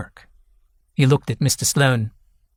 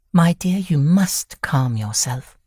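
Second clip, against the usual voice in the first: soothing; 4 semitones higher, slower than usual. A narrator's reading. Wife soothing laughing husband.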